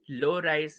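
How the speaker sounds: dropped low in pitch. Shown as a demonstration. Student reciting the intonation subtypes, voice low. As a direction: sink the voice for the low one. Names low rise, voice drops.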